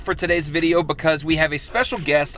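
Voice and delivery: vlog host intro. Acted. Host greets viewers with an upbeat intro voice. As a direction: sell the video to your viewers.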